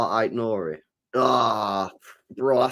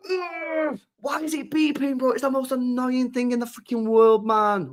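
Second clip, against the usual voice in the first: much higher, fed-up groan; high exasperated whine; 11 semitones higher than usual. Real, not acted. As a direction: whine it out in frustration. Irritated by beeping.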